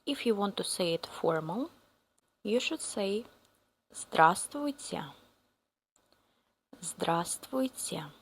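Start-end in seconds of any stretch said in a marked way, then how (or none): none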